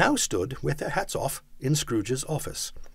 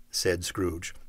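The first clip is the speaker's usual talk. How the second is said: plain reading voice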